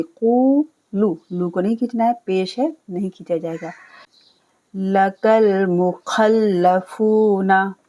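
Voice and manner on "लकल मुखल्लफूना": लकल मुखल्लफूना recited very slowly in tilawat style; slow chanted verse; slow recitation. Shown as a demonstration. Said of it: "बीच में जो खाली अलिफ है इसे नहीं पढ़ा जाएगा".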